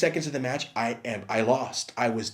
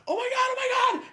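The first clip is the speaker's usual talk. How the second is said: panicked friend; 3 dB louder, much faster than usual. Retold or imitated. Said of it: "almost cry".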